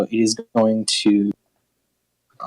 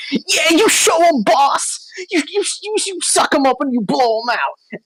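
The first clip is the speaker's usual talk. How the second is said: goblin voice. Acted. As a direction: cheer on the boss like a tiny goblin. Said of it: "he's gonna go like".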